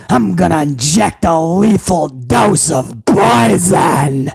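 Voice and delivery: slow, shouted villain vow; Vince McMahon vowing revenge. Retold or imitated. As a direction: drag out each threat like a cartoon villain. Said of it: "I will give you a little bit of Vince".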